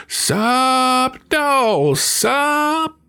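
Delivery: very high, loud, drawn-out yowl; cat's holler. A narrator's reading. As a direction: holler the chant at the top of the range. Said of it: "stuck it in and hollered".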